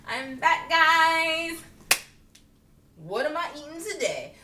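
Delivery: high, singsong mock announcement; sung-out playful hello; sing-song greeting. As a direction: announce yourself in a lilting tease. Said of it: "I'm like".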